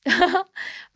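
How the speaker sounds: a high, embarrassed laugh through tears; teary laugh. Real, not acted. Guest laughs, embarrassed, as tears come on camera. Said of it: "I'm gonna be teary-eyed".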